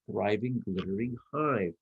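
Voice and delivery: ordinary delivery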